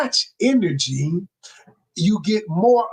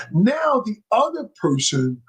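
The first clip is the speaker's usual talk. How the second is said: ordinary talk, nothing marked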